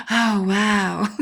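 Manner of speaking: a light, airy little gasp of wonder; mock awe. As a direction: marvel at it, half playful. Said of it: "sit there and go".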